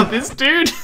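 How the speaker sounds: high, squeaky words through laughter; laughing. Real, not acted. Streamer cracking up at the player's reply, squeaky laughing. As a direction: laugh it out, helplessly amused.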